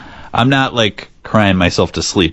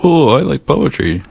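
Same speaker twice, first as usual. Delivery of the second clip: higher-pitched mock poetry fan; mocking impression of co-host; 7 semitones higher than usual. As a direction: tease her with a put-on, higher voice. Co-host mocks her for liking poetry.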